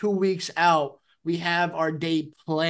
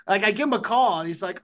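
ordinary talk

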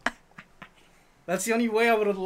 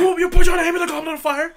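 much higher, louder hammed-up shout; mock frantic yell; 11 semitones higher, 11 dB louder than usual. Re-enacting the movie scene, a much louder hammed-up yell. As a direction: yell it like a manic wizard. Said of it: "He asked".

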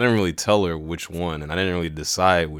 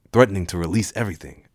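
ordinary talk

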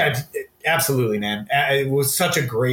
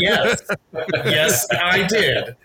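mock answer; 5 semitones higher, much slower than usual.

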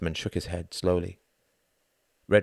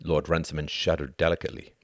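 plain reading voice

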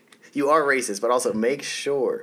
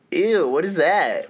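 loud, high, drawn-out revulsion; retold disgust; 8 semitones higher, 9 dB louder, slower than usual. Host retelling a first reaction to feet pics, exaggerated high disgust.

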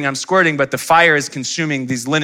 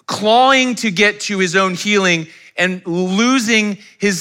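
high, loud passionate sermon; fervent pulpit preaching, raised high and loud; preaching at full force; 7 semitones higher, 4 dB louder than usual. Acted. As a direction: build the congregation's feeling.

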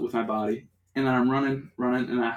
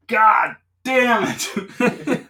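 drawn-out, very slow laughing yell, much higher and louder; laughing shout; 11 semitones higher, 9 dB louder, much slower than usual. Retold or imitated. Retelling getting pulled over again, howling the curse with laughter. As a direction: stretch the curse out in a big laughing howl. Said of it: "I'm like".

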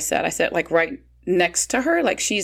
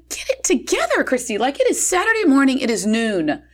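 much higher, mock stern pep talk; self-scolding; 6 semitones higher than usual. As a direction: snap yourself out of it. Scolding herself for crying.

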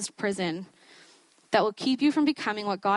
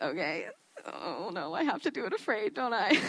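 dismayed realisation; 5 dB quieter than usual. Retold or imitated. Speaker realising she must do it afraid, comic dismay. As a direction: reluctantly let it dawn on you.

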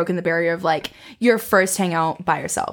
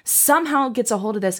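ordinary delivery

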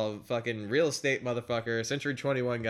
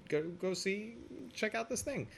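imitating Gaffigan; 8 semitones higher, 9 dB quieter than usual. Retold detective line, high soft.

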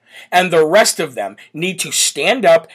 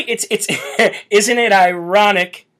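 ordinary talk

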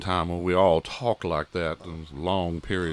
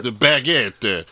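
mock French accent with an Italian flavour, slow, "The baguette"; Italian doing French; 4 dB louder, much slower than usual. Shown as a demonstration. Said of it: "Say like an Italian doing a French accent".

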